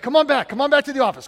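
urgent beckoning, acted out; retold urging. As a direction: imitate someone hurrying a friend back. Retold pastor urgently calling him back.

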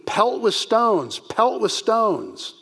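slow, high, each pelt with stones drawn out and falling; emphatic repetition. Shown as a demonstration. Teacher showing the Hebrew emphasis, pelt with stones slow and weighty. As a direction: spell out pelt with stones twice, slowly and weightily. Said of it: "It's very emphatic".

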